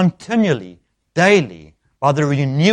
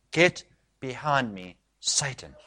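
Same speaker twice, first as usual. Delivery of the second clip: quiet, slow and measured rebuke; grave rebuke; 7 dB quieter, slower than usual. Acted. Jesus rebuking Peter to his face, grave quiet rebuke. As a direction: let each word land softly, with weight. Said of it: "he rebukes Peter. This is what he says".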